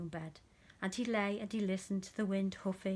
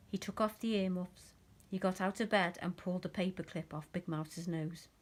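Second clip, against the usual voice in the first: ordinary delivery, nothing marked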